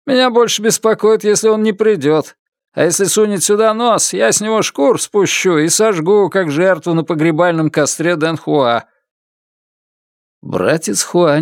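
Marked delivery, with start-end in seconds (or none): 0.1-8.8 dismissive guard, voiced higher; a higher, confident young guard; higher voiced brother. Lun boasting, higher voice.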